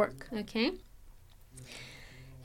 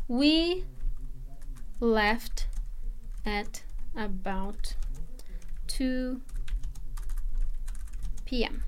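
We left at about two P M, slowly and spaced out; drawn-out teacher example; slow careful model sentence; slow demo; 3 dB quieter, much slower than usual. Shown as a demonstration. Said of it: "So I can say".

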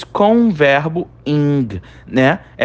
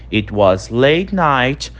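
slow model reading; much slower than usual. Shown as a demonstration. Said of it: "Então, era de madrugada quando ela chegou".

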